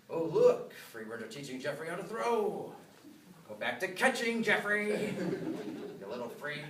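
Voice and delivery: gossipy neighbor voice. Retold or imitated. Neighbors mocking thrower.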